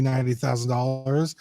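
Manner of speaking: normal talking voice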